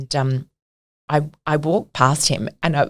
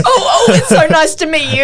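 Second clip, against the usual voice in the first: laughing fluster; 8 semitones higher, 5 dB louder than usual. Retold or imitated. Retold awkward goodbye, laughing.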